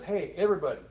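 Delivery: lightly acted call for everyone to come listen; eager colleague calling people in; editor on intercom. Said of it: "got on the intercom, and said".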